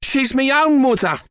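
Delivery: high, insistent woman's voice; protesting mother. A narrator's reading. Mother sides with the boy.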